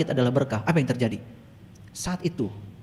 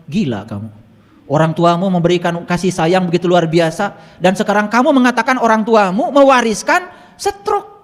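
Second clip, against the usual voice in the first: retold angry telling-off, rising higher and louder; scolding; 5 semitones higher, 6 dB louder than usual. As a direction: build from disbelief to a loud rebuke. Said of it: "Saya omelin". (mengomel dengan nada meninggi)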